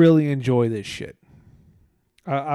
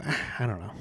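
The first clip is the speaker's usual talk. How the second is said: mumbled shrug; 4 semitones lower, 8 dB quieter than usual. Retold or imitated. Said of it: "they just go".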